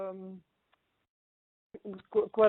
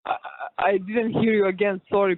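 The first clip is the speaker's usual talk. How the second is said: louder, straining to be heard; talking over a noisy line; 11 dB louder than usual. Real, not acted. Boxer on a noisy phone line telling the host she missed it, louder. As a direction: raise the voice over the noise.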